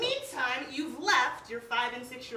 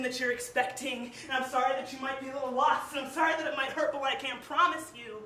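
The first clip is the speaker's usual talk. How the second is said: passionate slam poetry delivery, louder; spoken word poem; 4 semitones higher, 9 dB louder than usual. Acted. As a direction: perform it like a slam poet on stage.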